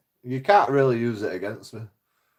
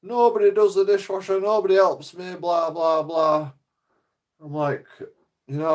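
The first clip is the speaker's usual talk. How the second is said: mimicking mum; 7 semitones higher, 3 dB louder than usual. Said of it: "She's like".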